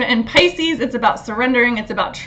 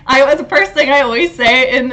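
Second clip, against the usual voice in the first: ordinary delivery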